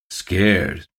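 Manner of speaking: scornful one-word question; sneering. A narrator's reading. Official looks down at the local drinker, sneering. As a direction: throw the word back with a sneer. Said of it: "Cribben sneered down at Love".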